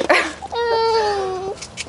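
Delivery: high teary laugh; real happy tears; laughing cry. Real, not acted. Wife overwhelmed by gift.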